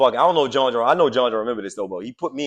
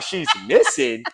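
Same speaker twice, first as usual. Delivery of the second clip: slow disbelief; 5 semitones higher, much slower than usual. Retold or imitated. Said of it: "I'm like".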